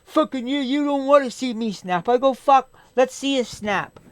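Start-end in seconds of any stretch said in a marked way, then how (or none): 0.1-2.1 high-pitched, loud mock threat; imitated attitude. Late-coming friend threatening to snap, imitated attitude. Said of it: "starts giving me an attitude". 2.3-4.0 higher, slow, challenging comeback; defiant retold dare. Supervisor daring his friend to snap, defiant retort.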